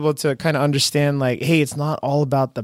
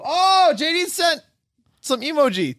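surprised; 14 semitones higher than usual. Real, not acted. Surprised by a message.

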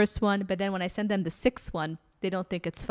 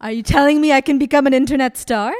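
thrilled; 5 semitones higher, 10 dB louder, faster than usual. Real, not acted. Thrilled at becoming a star.

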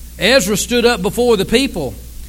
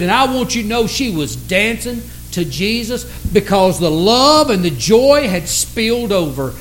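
usual speaking voice

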